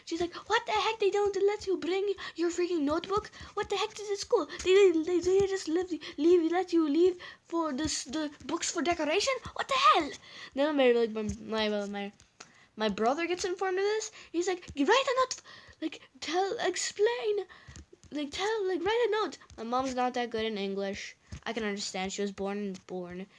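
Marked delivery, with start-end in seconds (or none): 0.3-10.1 high, exasperated mother's rant; angry mom impression. Teller mimicking mom railing at the school, angry. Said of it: "she was, like, freaking angry". 14.8-19.4 high, exasperated mimicked order; insistent demand. Teller mimicking brother being told to write note, insistent. Retold urgent demand in family row.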